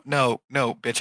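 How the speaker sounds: ordinary talk, nothing marked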